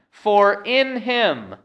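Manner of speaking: slow, with "in" high and strong; stress on "in". Shown as a demonstration. Preacher reads verse nine, stressing "in". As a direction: stretch and punch "in", let "him" fall. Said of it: "emphasizing the word in".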